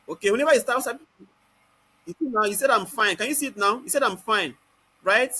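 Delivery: normal talking voice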